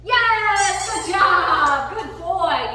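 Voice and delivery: high, loud celebratory whoop; excited praise. Real, not acted. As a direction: cheer the dog on jubilantly. Praising dog's success.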